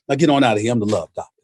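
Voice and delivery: low, soft, smooth sendoff; Love Doctor voice. Retold or imitated. Love Doctor waving off a couple, low and soft. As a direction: drop deep and quiet to dismiss them. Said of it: "he'd go like this".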